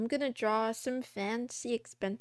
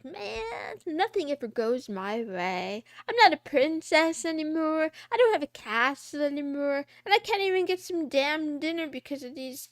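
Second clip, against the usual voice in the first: hammed-up, higher-pitched whining; self-pitying complaint; 5 semitones higher, 6 dB louder than usual. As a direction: lament theatrically, getting louder. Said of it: "she's outside like".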